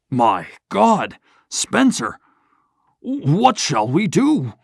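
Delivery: the banker begging for help; shaky voice. A narrator's reading. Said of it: "in a shaky voice".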